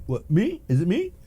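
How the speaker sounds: high, mock-puzzled questions; acting clueless. Speeder looking behind when hailed, acting clueless. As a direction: squeak out innocent disbelief with rising pitch. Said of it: "looking behind you".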